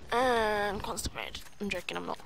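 ordinary talk

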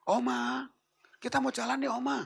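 high, loud call to someone behind a door. Acted. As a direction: call out for grandma to hear. Calling through a closed door.

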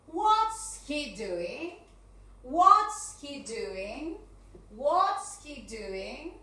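slow, stretched question with rising end; drawn-out teacher's model question; slow question drill. Shown as a demonstration. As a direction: drill it slowly, lifting the end.

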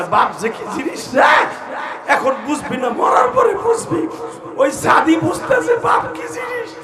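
very high, slow, loud cry; wailing preaching shout. Real, not acted. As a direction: stretch each word out like a lament.

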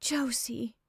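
hushed, shocked whisper of her sister's name; horrified whisper. A narrator's reading. Laura whispers to Josie behind the door, horrified whisper. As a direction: breathe the name in horror. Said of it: "she said, horrified".

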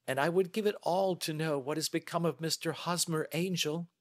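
high-pitched, imploring woman's voice; earnest lady client, pleading. Acted. Client begs Holmes to find a missing man, earnestly pleading. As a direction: plead your case to the detective. Narrator plays pleading Miss Sutherland.

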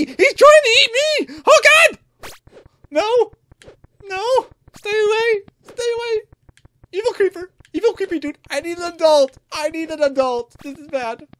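very high, loud shrieks; panicked screaming. Real, not acted. Player fleeing a creature trying to eat him, panicked screaming. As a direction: scream for help as if being chased.

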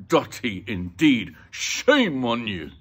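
loud, slow indignant scolding; angry telling-off, drawn out. Acted. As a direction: scold them, hitting each word hard. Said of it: "turned angrily to the policeman".

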